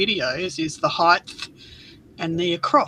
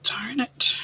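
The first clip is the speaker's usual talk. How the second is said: whispered annoyance; 3 semitones higher than usual. Real, not acted. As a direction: mutter it under your breath, annoyed. Small craft mishap.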